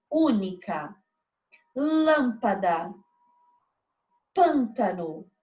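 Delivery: Única, LÂMpada, PÂNtano, each first syllable leaned on; spaced-out examples única, lâmpada, pântano, hitting the first syllable; stress on antepenultimate syllable. Shown as a demonstration. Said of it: "a sílaba que eu pronuncio com mais intensidade". (única, lâmpada, pântano com ênfase na primeira sílaba)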